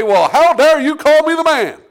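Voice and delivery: loud, high, indignant outburst; a king who refuses the prophet's rebuke, loud and high; offended king. Retold or imitated. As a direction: bristle with wounded pride. He didn't say.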